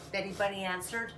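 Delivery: ordinary delivery, nothing marked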